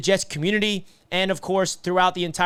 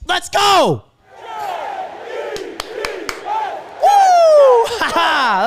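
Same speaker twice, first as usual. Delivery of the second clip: screams his joy, much higher and louder, laughing; ecstatic celebration; 11 semitones higher, 5 dB louder, slower than usual. Real, not acted. Fan at the watch party celebrating the Jets' first win, ecstatic screaming. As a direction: let it all out like your team just won.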